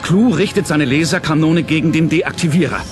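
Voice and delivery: ordinary talk, nothing marked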